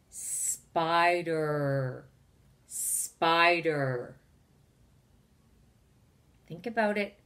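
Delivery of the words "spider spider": "spider" slow, with a long held er; stretched end sound. Shown as a demonstration. Teacher quizzes children on word endings, "spider" ending in long er. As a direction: linger on the er that ends "spider". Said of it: "at the end of the word".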